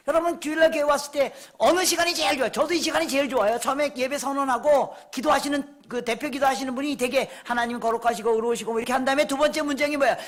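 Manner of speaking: animated, high-pitched pulpit voice; forceful preaching. Real, not acted. Preacher quizzing congregation about Sunday worship, forceful preaching. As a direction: fire questions at the congregation.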